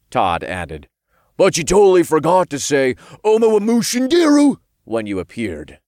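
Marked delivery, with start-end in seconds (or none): 1.4-5.8 loud, high-pitched exclamations; excited imp. A narrator's reading. Imp chides friend over a forgotten catchphrase, excited. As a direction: blurt it like an excitable pal.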